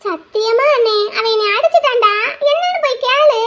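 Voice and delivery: pleading like a wronged little sister; whiny pleading. Acted.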